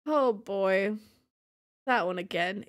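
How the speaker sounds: ordinary talk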